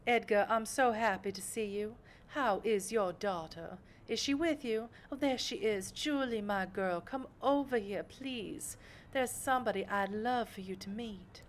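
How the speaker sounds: lightly voiced refined socialite; gracious vampire patron. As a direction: greet an old acquaintance with poised delight. Voices Sophie greeting a guest.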